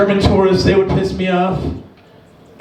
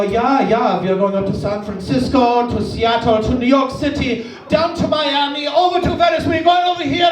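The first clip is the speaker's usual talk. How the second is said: put-on German accent climbing in pitch and excitement; 3 semitones higher than usual. German tourist listing endless destinations, mounting German accent. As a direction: reel off the list in a rising German accent. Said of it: "And they go".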